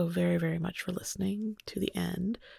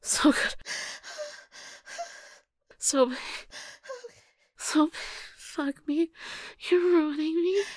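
breathless moaning; 10 semitones higher than usual. Acted. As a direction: build from gasp to peak. Moaning during climax.

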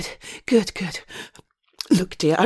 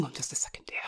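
whispered aside; 7 semitones lower, 16 dB quieter, faster than usual. Acted. Aside while on phone.

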